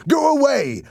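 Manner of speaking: terrified shout, high and loud. A narrator's reading. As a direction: yell it to drive a ghost off. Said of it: "I shouted".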